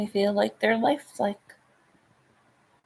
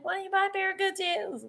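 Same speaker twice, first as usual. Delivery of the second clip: Tracy's voice; 10 semitones higher, 6 dB quieter, faster than usual. Retold or imitated. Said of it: "Tracy's like".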